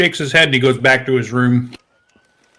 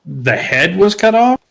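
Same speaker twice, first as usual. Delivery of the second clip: higher-pitched, incredulous question; mild mock shock; 6 semitones higher than usual. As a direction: ask it in mild disbelief. Acted shocked question in character.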